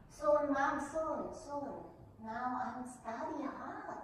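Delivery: high-pitched put-on promise to mom; reassuring fib. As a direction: reassure your mother a bit too eagerly. Retold reassuring fib to her mother.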